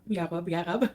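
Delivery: Israeli-accented Ya Rab. Real, not acted. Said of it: "saying it in an Israeli accent".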